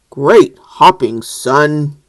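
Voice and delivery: low, loud proud praise; deep hearty father; dad voice. Acted. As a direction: praise like a booming frog dad.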